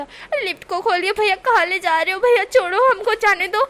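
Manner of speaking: high, loud panicky shouting; panicked yelling. Acted. Wing trapped in lift, loud fake panic. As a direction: bang on the lift and yell. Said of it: "wing चिल्ला रहा था".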